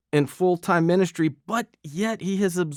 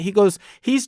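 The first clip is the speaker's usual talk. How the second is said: ordinary talk, nothing marked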